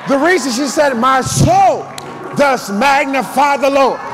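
forceful, rhythmic sermon delivery; full-force preaching. Real, not acted. Preacher urging the church to magnify the Lord, full-force preaching. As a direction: drive each phrase out to the whole church.

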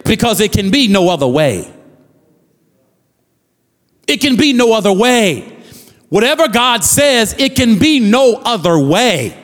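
fervent preacher cadence, pressing each line; preaching. Acted. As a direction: preach it to the congregation. Preaching at full force.

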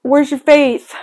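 a playful retold scolding, up and loud; mock husband's rebuke. Husband teasing her worry, mock loud rebuke. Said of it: "My husband said to me".

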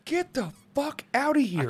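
high, incredulous exclamation; can't believe the price; stunned disbelief. Real, not acted.

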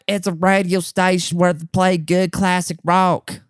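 high-pitched dopey mockery; stupid customer voice. Customer explaining his favorite station to the worker, stupid mocking voice. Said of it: "like this in his stupid voice".

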